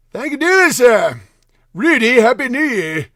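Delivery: put-on Trump done in an old British accent; a Trump bit in an old British accent. Streamer reading a fan's greeting in old British-accented Trump. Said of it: "I'm trying to do a Trump with a old British accent".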